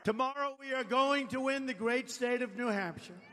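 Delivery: rising rally cadence; rally speech. Real, not acted. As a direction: address the crowd with rising force. Campaign rally address.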